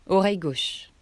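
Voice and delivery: usual speaking voice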